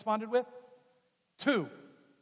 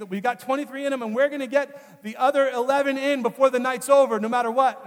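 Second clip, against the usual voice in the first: pushy nurse voice, higher and louder; determined nurse; 6 semitones higher, 7 dB louder than usual. Retold or imitated. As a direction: insist on the rest, no matter what. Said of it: "And he's like".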